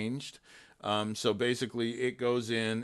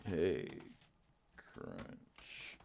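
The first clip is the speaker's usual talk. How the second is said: soft, breathy wake word to the assistant; quiet voice command; 6 semitones lower, 13 dB quieter than usual. Real, not acted. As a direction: murmur the wake phrase into the mic. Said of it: "I can come in here and say".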